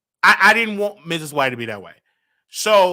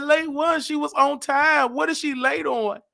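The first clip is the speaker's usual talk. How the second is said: higher-pitched naive bewilderment; bewildered protest; 8 semitones higher than usual. Retold or imitated. Son puzzled by mother's word late, bewildered protest. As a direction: protest in confusion, acted.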